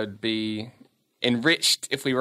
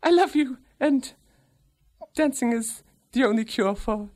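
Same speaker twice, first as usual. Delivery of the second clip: man doing a woman's voice; 13 semitones higher than usual. Acted. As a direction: coax him high and sweet. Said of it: "Where's your lady voice?".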